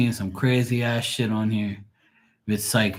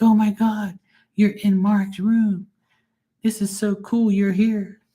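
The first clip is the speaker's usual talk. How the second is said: higher, gushing fan voice; mock gushing; 9 semitones higher than usual. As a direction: gush like an excited fan. Fans gushing over Mark's room.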